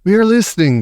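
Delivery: crying out eagerly; excited witch audience; eager witches. Acted. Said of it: "cried the audience".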